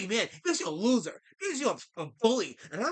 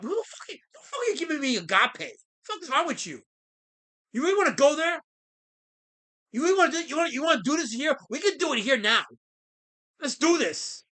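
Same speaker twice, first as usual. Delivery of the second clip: mock challenge; 5 semitones higher than usual. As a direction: square up to him, half joking. Mock confrontation with a chatter.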